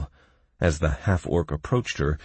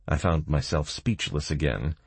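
ordinary delivery

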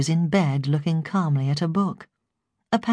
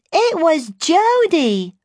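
put-on very high, slow, louder toddler voice; heightened baby voice; 13 semitones higher, 6 dB louder, much slower than usual. A narrator's reading. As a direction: insist like a baby, stretching the words. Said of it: "heightening her baby voice".

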